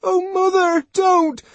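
very high, loud plea; frightened child's cry. Acted. Boy begging his mother to stop slapping, high frightened cry. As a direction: beg loudly in a small child's voice. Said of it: "he cried out".

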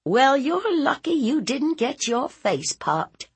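high-pitched scolding retort; indignant aunt. Acted. Aunt warning nephew he was lucky, indignant.

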